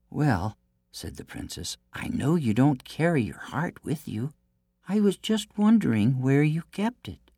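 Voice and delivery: high, sweet innocent princess; theme park princess. Acted. Princess coaxes giant.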